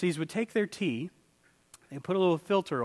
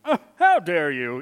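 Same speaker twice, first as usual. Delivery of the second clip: mock indignant Pharisee, louder; a hint of a snooty Pharisee, hammed-up; 7 dB louder than usual. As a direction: huff it out like an offended snob. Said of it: "they get the little snooty voice".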